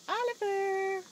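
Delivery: bright high-pitched name; high excited call. Shown as a demonstration. Parent modeling a name for baby, high excited call.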